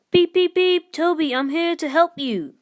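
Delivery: high, loud whistle and call. A narrator's reading. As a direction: call out across the snow. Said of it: "called Thomas".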